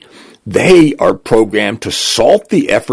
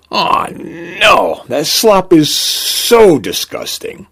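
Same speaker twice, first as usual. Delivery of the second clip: high, drawn-out whine of disgust; 7 semitones higher, slower than usual. Acted. Client protests drinking the Groff solution, disgusted. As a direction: drag out the revulsion on a raised pitch.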